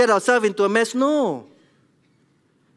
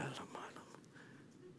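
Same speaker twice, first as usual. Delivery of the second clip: breathless whispered alamak; dismayed whisper; 35 dB quieter than usual. Retold or imitated. Dad whispering in dismay after shouting at his daughter.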